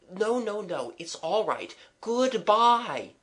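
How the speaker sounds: put-on high, dismissive children's farewell; hasty reassurance. Children seeing the young man off, high reassurance. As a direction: wave away his worries, pitched right up.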